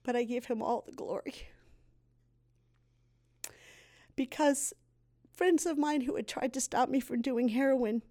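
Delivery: fighting back tears as she goes on; real emotion breaking through her testimony; choked up. Real, not acted. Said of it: "sorry, I get choked up".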